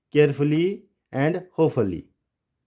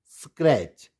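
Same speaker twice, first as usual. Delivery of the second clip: normal talking voice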